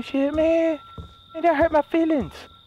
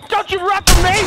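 louder, higher threat; menacing shout; 7 semitones higher, 6 dB louder than usual. Acted. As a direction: yell it at the fleeing victim. Killer taunting a fleeing victim.

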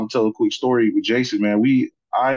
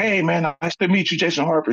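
higher, hearty greeting of a tough coach; a hint of a gruff coach saying hello; sports coach voice; 6 semitones higher than usual. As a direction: imitate the coach meeting you, lightly done. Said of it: "has a scratchy, tough, you know, sports coaching voice".

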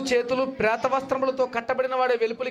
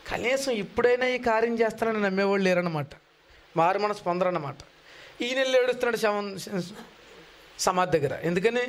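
ordinary delivery

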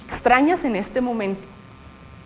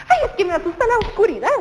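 high, fast, laughing through a claim of fear; cheerful; 8 semitones higher, much faster than usual. Shown as a demonstration. Teacher shows fear words said joyfully, laughing. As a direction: giggle while claiming you're scared. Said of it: "tiene mucha alegría".

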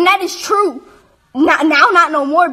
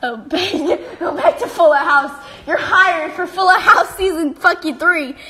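laughing taunt. Real, not acted. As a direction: taunt him through a laugh. Laughing, mocking the rival.

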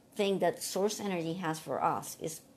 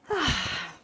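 long audible sigh; weary sigh; 3 semitones higher than usual. Retold or imitated. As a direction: let out a heavy sigh. Sigh recalling crying all day.

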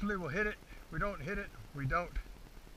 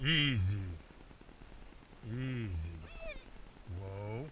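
soft, low soothing commands; calming the dog; 4 semitones lower, 16 dB quieter, much slower than usual. Real, not acted. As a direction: settle the excited dog gently. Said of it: "so I'm gonna have him calm down".

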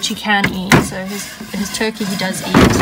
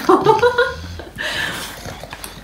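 high breathy giggle. Real, not acted. Laughing at the pig.

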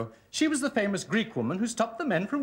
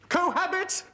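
shy blurt; 8 semitones higher than usual. Acted. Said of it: "I can't say it out loud".

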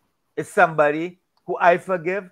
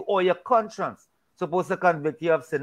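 usual speaking voice

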